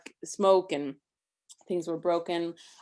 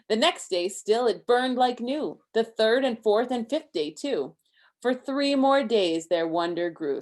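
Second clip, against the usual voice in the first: lively rhyming read-aloud, a bit higher and louder; story reading; 6 semitones higher, 6 dB louder than usual. A narrator's reading. Parent reading a Hanukkah book to Isaac, lively rhyme. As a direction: read the rhymes to a child with bounce.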